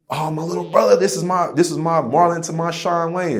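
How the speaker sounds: high, excited voice of a proud big brother. Retold or imitated. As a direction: boast about your little brother, voice lifted high.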